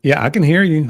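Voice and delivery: normal talking voice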